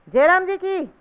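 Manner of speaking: high, loud greeting shout; loud hail of જય રામજી કી; calling out. A narrator's reading. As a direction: call out to the temple from the doorway. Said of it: "બાબુએ બૂમ લગાવી".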